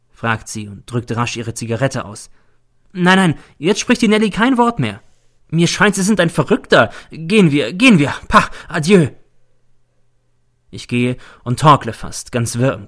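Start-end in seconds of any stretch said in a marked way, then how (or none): 2.9-9.1 higher, louder and dismissive; agitated refusal. A narrator's reading. Nelly thinks the visitor is mad, agitated refusal. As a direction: cut him off and send him away. Frightened woman breaks off the talk.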